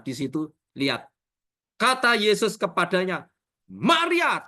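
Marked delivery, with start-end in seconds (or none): 3.7-4.4 high exclaimed name; stern warning call. Acted. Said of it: "Itu peringatan keras". (seruan peringatan keras)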